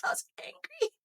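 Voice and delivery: high, unvoiced admission; whispered confession. Real, not acted. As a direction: whisper it like a secret. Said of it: "If I'm being honest".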